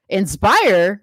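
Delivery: scoffing, incredulous question; sarcastic echo. Real, not acted. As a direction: throw the word back with disbelief. Echoes quote word mockingly.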